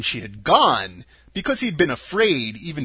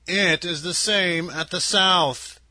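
slow, measured little-man voice with a drop at the end; slow Munchkin man; 3 semitones higher, slower than usual. A narrator's reading. Munchkin telling Dorothy the south is desert, slow and grave. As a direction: ponder it slowly like a grave Munchkin. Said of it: "said another".

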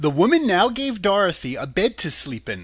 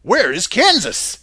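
slow, louder, puzzled surprise; surprised; 4 semitones higher, 7 dB louder, slower than usual. A narrator's reading. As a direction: stretch it out, baffled. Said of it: "said the man in surprise".

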